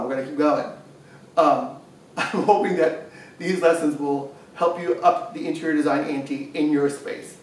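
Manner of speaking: ordinary delivery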